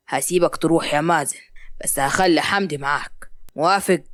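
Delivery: low-pitched, measured permission; stern father. Acted. Hisham lets Mazen visit his mother, stern. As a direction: grant it in a deep, lightly acted voice.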